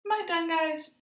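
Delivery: high, playful mock doubt; teasing the class with a mock question. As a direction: pretend you think you're finished. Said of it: "Now I forgot something".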